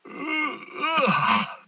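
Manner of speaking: long high groaning sigh; bored groan. Acted. Villain waiting on Batman's progress, bored groan. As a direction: groan with exaggerated impatience.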